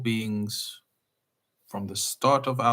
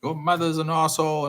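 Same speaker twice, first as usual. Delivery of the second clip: mock road rage; 7 semitones higher than usual. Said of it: "The words we use towards that guy".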